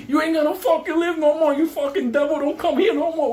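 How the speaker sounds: high, loud raging threats; acted furious yell. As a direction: scream threats mid-fight, re-enacted. Acting out attack on devil.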